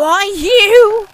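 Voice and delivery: loud, high sputtering anger; offended captain's snap; indignant outburst. Acted. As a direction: flare up at the cheeky kid. Argument with a child.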